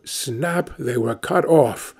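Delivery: ordinary delivery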